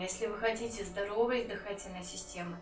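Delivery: ordinary delivery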